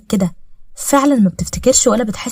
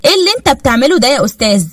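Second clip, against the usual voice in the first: sarcastic catching out; 6 semitones higher than usual. Acted. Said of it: "دخلت أسيف وقالت".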